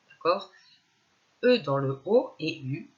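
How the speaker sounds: normal talking voice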